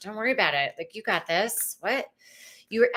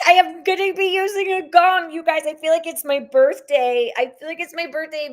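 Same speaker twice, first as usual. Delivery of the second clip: much higher and louder, bubbling over; excited; 8 semitones higher, 6 dB louder than usual. Real, not acted. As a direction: gush like it's your birthday. Excited about gong news.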